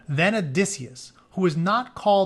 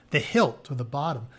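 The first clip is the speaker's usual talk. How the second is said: plain reading voice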